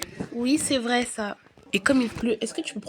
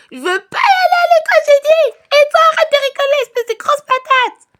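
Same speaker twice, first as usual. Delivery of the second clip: put-on very high shouted toddler tantrum; crying toddler; 12 dB louder than usual. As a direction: scream it like a furious little girl. Toddler Sophie refuses, yells at sister.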